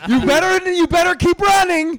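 high, loud yelled threat; shouted threat. Retold or imitated. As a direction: yell it after someone running away. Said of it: "me and Andrew are like".

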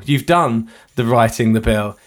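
ordinary talk

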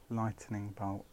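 "Lightning bolt" softly, as light-uh-ning bolt; extra syllable in lightning. Shown as a demonstration. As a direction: add a small vowel after the T in "Lightning". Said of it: "You put an extra T in it".

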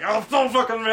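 high, loud, hammed-up fury; mock rage through a growled grumble; eating angrily. Driver dipping nuggets while retelling his rage, eating angrily. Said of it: "I never ate so angry".

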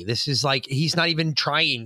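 usual speaking voice

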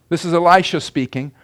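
usual speaking voice

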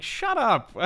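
high, laughing dismissal; jokingly swat the line away; mock exasperation. Mock retort to a cheesy line.

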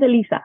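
"Elisa" with a long ee in the middle; correct name pronunciation. Shown as a demonstration. Guest correcting host on their name, "Elisa" with LEE in the middle. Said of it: "my name is pronounced".

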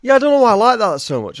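gleeful outburst. Real, not acted. Laughing excitedly at the joke.

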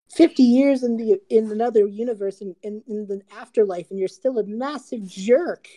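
high-pitched mock indignation; exasperated. Caleb to his best friend after fifty years apart, exasperated. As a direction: scold an old friend, voice pushed up high.

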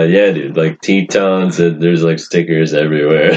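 usual speaking voice

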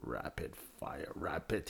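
"Rapid fire" whispered, slow; whispered imitation. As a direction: breathe "Rapid fire" slowly. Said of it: "He says rapid".

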